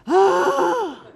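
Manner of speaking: big, loud, high mock gasp; horrified gasp. Student told of a silent work period, exaggerated horrified gasp. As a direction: gasp as if aghast at the news. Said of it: "my reaction".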